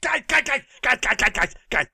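rapid repeats, starting high and settling; director cutting in. Acted. Film director stopping the crew with an idea, urgent interruption. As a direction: break in to get everyone's attention.

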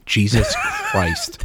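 high, slow laugh through Jesus Christ; shocked laughing exclamation; laughing disbelief. Real, not acted. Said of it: "That is unhealthy".

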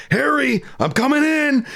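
imitated Harry call-out. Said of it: "I love when he does that".

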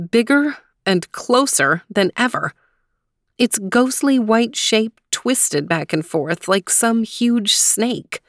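usual speaking voice

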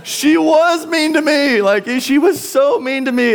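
put-on high, loud, slow tearful wail for the child's voice; dad mimicking his son crying to him; imitating his crying son. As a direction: mimic a hurt boy sobbing out his complaint. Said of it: "And he was like".

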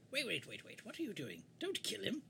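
high, urgent in-character plea; fretful character, pitch leaping up. Acted. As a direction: plead in a put-on high voice. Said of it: "Say it in your character voice".

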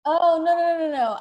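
loud flustered no no no; frantic denial. Acted. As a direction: backpedal in a panic.

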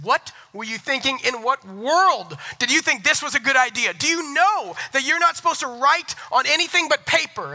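high, loud exasperated scolding. Retold or imitated. Dad scolding his daughter over the marker hearts, loud and exasperated. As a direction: scold her, pitch climbing and loud. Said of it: "my voice might have raised a couple decibels".

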